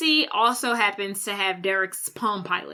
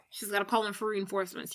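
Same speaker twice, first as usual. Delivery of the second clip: softer, fast throwaway repeat of "reinforcements"; muttered aside; 3 semitones lower, 9 dB quieter, much faster than usual. Shown as a demonstration. Host repeating her own line as a soft quick aside. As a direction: toss it off under the breath.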